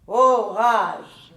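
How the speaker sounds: orage lancé fort et grave, façon tragédienne; déclamation tragique. Acted. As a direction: clame le mot comme sur scène. Récite Corneille, essaie le texte. (déclamation théâtrale forte)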